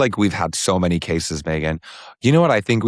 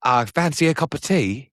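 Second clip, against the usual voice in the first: mock British accent; 7 semitones higher than usual. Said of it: "say everything British we can think".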